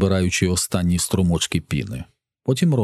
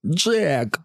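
high, frightened yelp without extra volume; near screech; 8 semitones higher than usual. A narrator's reading. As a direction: blurt it high, almost screeching. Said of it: "мало не верещить він". (майже вереск від страху)

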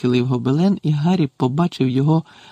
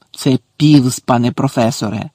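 usual speaking voice

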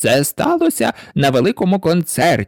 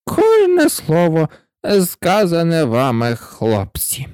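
hoarse, feeble voice; 5 semitones higher, slower than usual. A narrator's reading. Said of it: "охриплим, кволим голосом".

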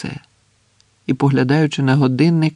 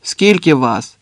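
moderately raised challenge; shouting; 3 dB louder, slower than usual. A narrator's reading. Same sentry to the stranger, calling out. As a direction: call the question out. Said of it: "той самий голос крикнув знову".

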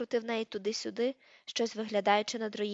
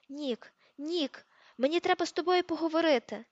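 голосно й високо гукати крізь гамір; перекрикни галас, щоб покликати друга; гучний оклик; 6 semitones higher, 4 dB louder than usual. A narrator's reading. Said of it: "і закричав щосили". (гучний крик, щоб перекрити гамір)

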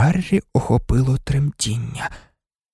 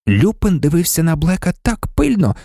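plain reading voice

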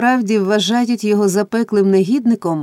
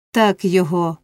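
ordinary delivery

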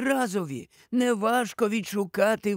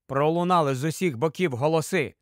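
usual speaking voice

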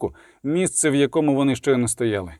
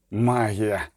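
grumbled; 4 semitones lower than usual. A narrator's reading. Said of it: "пробурчав Скелен".

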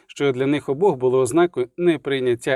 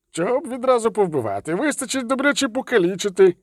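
protesting dwarf; 8 semitones higher than usual. A narrator's reading. Dwarf objects to Geralt.

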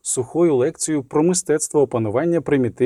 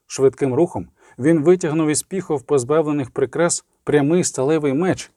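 ordinary delivery, nothing marked

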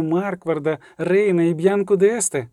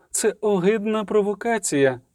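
usual speaking voice

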